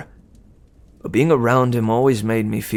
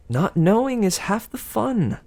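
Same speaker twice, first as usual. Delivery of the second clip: sympathetic Aphrodite; 10 semitones higher than usual. Acted. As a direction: smile through it. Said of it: "smiled sympathetically".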